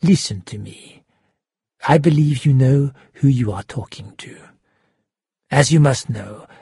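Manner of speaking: restrained, menacing villain voice. A narrator's reading. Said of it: "quieter voice".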